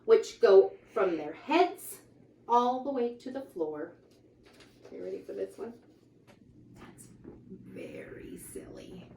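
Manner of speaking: lively, sing-song picture book reading; reading to children. A narrator's reading. Reading a rhyming book aloud to children, lively. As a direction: read the rhymes out brightly to the kids.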